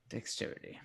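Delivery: soft unvoiced mutter; whisper. Real, not acted. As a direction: murmur it to yourself. Muttered roll result.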